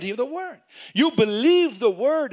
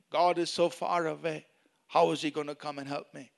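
acted hopelessness, lower and softer; hopeless; 4 semitones lower, 5 dB quieter than usual. Preacher acts out believers doubting God will help, subdued hopelessness. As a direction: voice a doubter sinking into mild despair. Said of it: "They don't speak hopelessness".

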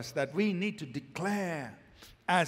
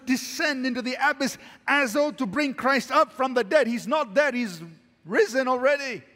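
higher-pitched, urgent exhortation; forceful preaching; 5 semitones higher, 6 dB louder than usual. Real, not acted. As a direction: admonish the congregation with energy.

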